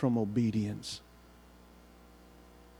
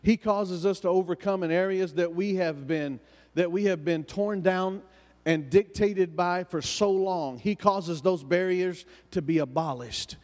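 rising, fervent preacher cadence; preaching; 5 semitones higher than usual. Acted. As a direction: preach it, building in pitch. Preaching build-up on the blood.

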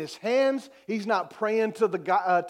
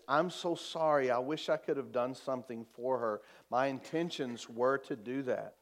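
lightly voiced counselor, low and soft; normal counselor; 8 semitones lower, 8 dB quieter than usual. Counselor apologising to a grieving father, mild and subdued. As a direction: offer a subdued, softened apology. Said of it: "what would you expect a normal counselor".

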